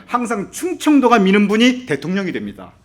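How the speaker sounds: normal talking voice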